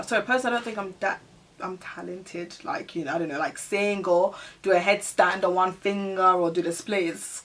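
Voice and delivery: ordinary talk, nothing marked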